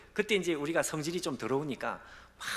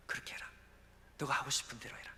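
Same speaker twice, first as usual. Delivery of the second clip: hushed, gentle whisper; soft permission; 6 dB quieter than usual. Acted. God releasing the pastor, soft hushed voice. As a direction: let him go gently.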